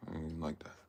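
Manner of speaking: soft whispered aside. Real, not acted. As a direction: drop to a whisper, half to yourself. Muttered aside.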